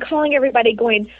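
normal talking voice

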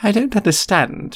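prim McGonagall. A narrator's reading. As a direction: chide him lightly.

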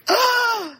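startled gasp. Retold or imitated. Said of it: "she's like".

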